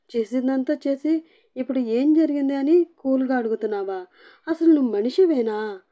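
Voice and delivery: drawn-out crying. A narrator's reading. Wife accuses her husband, lightly voiced drawn-out crying. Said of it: "సాగదీస్తూ ఏడుస్తూ".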